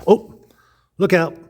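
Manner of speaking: real pleased surprise; surprised. Real, not acted. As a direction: catch yourself, delighted to be wrong. Spots George Washington in Bible.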